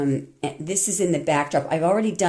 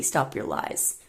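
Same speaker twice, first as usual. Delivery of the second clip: hushed, softer and lower; emotional whisper; 7 semitones lower, 7 dB quieter than usual. Real, not acted. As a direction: breathe it out intensely. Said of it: "I just get very emotional about that".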